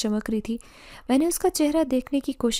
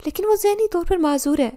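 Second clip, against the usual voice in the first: higher, louder desperate plea; anguished protest; 7 semitones higher, 5 dB louder than usual. A narrator's reading. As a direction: burst out in distressed objection. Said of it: "میں تڑپی". (تڑپ کر احتجاج)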